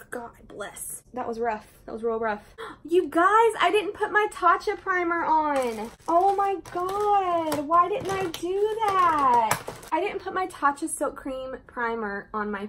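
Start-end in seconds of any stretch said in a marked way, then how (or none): 2.6-5.9 gasp, then high and loud in alarm; sudden realization. Real, not acted. To her viewers, realizing she skipped the primer: high, loud gasping alarm. 6.1-9.6 slow, high and loud self-reproach; real dismay. Real, not acted. To her viewers, annoyed at her own mistake: slow, high, loud wail.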